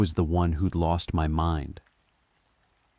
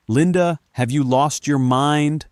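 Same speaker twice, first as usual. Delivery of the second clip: high, loud outraged question; shouted; 8 semitones higher, 7 dB louder than usual. A narrator's reading. Narrator just dodged Linda's lamp, shouting. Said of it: "I shouted".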